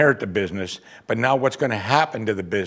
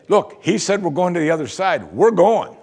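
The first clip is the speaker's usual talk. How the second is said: confident retort; 6 semitones higher, 4 dB louder than usual. Pretend. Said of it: "Not one of them said".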